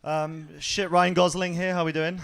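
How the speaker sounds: ordinary talk, nothing marked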